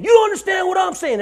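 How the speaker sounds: high-pitched shouted insistence; agitated retold outburst. Possessed man protests to the officers, agitated shouting. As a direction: cry it out high and loud, imitating a possessed man.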